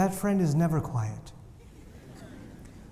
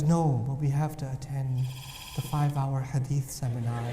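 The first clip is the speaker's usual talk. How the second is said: lower, softer studious voice; a lightly voiced bookish friend; quiet intellectual; 6 semitones lower, 5 dB quieter than usual. Quiet friend insisting on the seminar, lower and softer. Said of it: "the inquisitive friend says".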